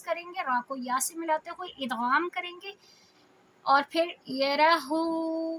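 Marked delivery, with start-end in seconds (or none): 3.6-5.6 یرہو slow with a rounded ہو; Quran recitation. Shown as a demonstration. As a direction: chant یرہو, lips round on the ہو. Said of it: "ہو گول والا ہا". (یرہو میں ہو گول کر کے پڑھیں)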